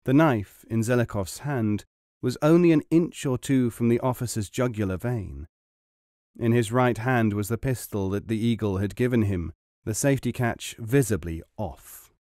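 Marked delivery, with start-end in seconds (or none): none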